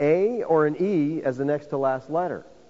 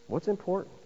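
ordinary delivery

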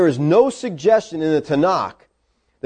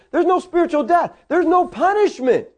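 "no" sung out high on each line; emphatic no; 11 semitones higher than usual. Acted. Preacher voicing Paul's promise to the church, high and ringing. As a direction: ring out "no" like a proclamation.

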